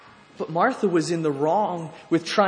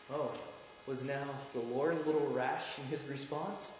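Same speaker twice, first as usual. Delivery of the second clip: normal talking voice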